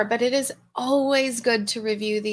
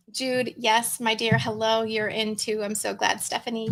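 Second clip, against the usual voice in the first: ordinary talk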